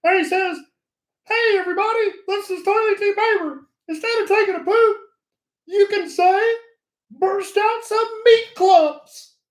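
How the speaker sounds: high squeaky toilet paper puppet voice. Retold or imitated. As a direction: put on a high cartoon puppet voice. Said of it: "he kinda talks like this".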